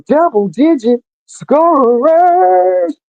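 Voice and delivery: stretched-out, high announcer finish; sportscaster's sing-out on the final word; announcer flourish. Acted. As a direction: hold the last word long and high. Signing off with drawn-out flourish.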